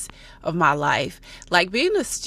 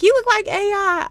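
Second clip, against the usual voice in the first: mock commenter; 14 semitones higher, 6 dB louder than usual. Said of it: "people who see my video was like".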